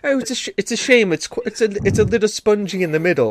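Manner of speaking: high, quick, apologetic English fussing; hammed-up polite baking critic; mock-polite English. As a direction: break bad news with prim regret. Polite English judge before blunt translation.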